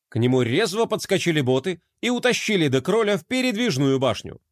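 ordinary delivery

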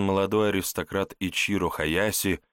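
usual speaking voice

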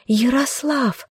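high, drawn-out call from afar; girl calling to her beloved from the window. Acted. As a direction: call out to him across the field. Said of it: "громко крикнула она ему".

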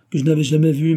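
toujours fort et haut; s'écrie. Acted. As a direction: continuer le cri de protestation. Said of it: "s'écria Bidart". (l'accusé proteste en criant)

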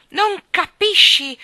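put-on loud, slow angry child roar; a furious little boy roaring. A narrator's reading. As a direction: roar it in fury. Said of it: "ruggì furioso John".

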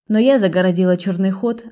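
plain reading voice

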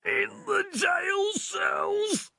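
very high, quiet strangled squeak; gasping in pain. A narrator's reading. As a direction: squeeze it out slowly, in agony. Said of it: "he gasped".

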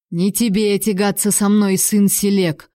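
plain reading voice

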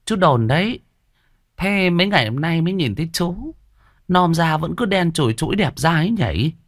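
put-on high-pitched nagging old shopkeeper; old woman voice. Old shop owner nagging the debtor, high old woman voice. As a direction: needle him about his debt like a sharp old woman.

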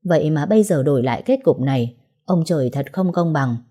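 plain reading voice

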